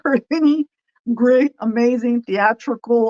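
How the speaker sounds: usual speaking voice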